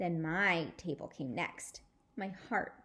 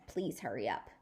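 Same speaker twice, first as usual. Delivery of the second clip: impatient whisper; 9 semitones lower, 5 dB quieter than usual. Acted. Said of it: "The daddy said".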